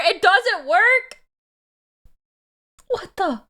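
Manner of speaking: high, incredulous squawk; mock outrage. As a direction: protest loudly in comic indignation. Mock outrage at chat's accusation.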